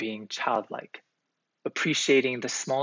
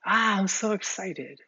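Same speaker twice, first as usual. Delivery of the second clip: imitating thrilled kids, pitched way up; brimming with joy; 8 semitones higher than usual. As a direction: gush like an overjoyed child. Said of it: "brimming with joy".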